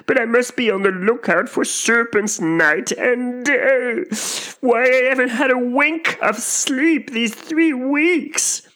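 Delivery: very high, tearful bird wail; exhausted mother bird's drawn-out cry; distraught pigeon. A narrator's reading. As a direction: lament slowly, close to sobbing. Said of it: "said the pigeon".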